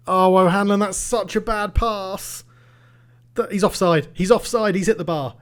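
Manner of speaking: frantic groan at a bad pass; frantic outcry. Real, not acted. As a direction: cry out as the chance goes begging. Frantic reaction to chances.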